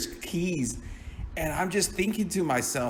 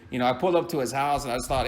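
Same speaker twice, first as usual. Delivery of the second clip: usual speaking voice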